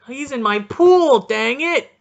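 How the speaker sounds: sudden loud high groan of frustration; frustrated outburst. Real, not acted. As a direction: blurt it out, really annoyed. Reacting to player's injury in fantasy pool.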